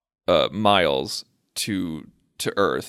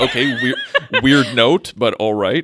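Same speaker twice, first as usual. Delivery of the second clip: laughing; 3 semitones higher, 6 dB louder than usual. Retold or imitated. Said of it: "They're like".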